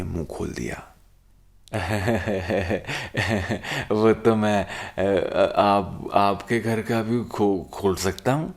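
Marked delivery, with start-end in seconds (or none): 1.7-3.9 put-on loud sloppy drunken laugh; drunken giggle. 3.9-8.5 put-on loud, slurred slow drunk boast; town drunk. Drunk Chiku blurts boast.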